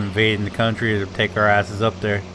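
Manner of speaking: usual speaking voice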